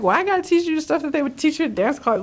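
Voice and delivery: quick, high-pitched imitated objection; mock protest. Korean class teachers balking at dance words, quick high protest. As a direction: rattle it off high, questioning the point.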